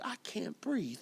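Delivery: soft whispered refrain; hushed plea. Acted. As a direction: breathe it out with no voice.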